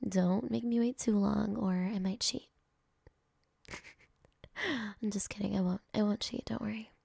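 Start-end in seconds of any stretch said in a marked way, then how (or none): none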